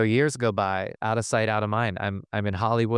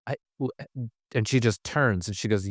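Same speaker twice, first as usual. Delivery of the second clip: ordinary delivery, nothing marked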